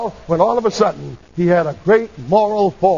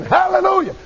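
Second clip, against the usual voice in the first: high, exultant cry; old man's sudden praise shout; shouted hallelujah; 11 semitones higher than usual. Retold or imitated. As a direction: call it out high, all at once. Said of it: "the old man shouted again".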